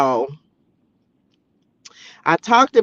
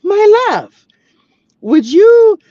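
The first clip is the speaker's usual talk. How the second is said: high, slow mock dramatic man's plea; movie character quote; 11 semitones higher, 4 dB louder, slower than usual. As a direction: ham up the love line, high and slow. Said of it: "he was like".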